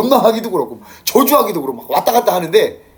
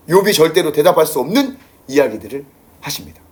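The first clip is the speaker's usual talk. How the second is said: usual speaking voice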